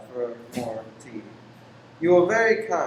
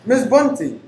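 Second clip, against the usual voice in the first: higher, louder sharp call of her name; sharp startled call; 7 semitones higher, 8 dB louder than usual. Acted. Lodger startled by the landlady walking in, sharp call. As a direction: snap her name out, caught off guard.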